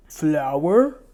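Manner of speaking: loud mocking call, slowed; sarcastic calling out. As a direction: tease her with a loud mock search. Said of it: "he said sarcastically".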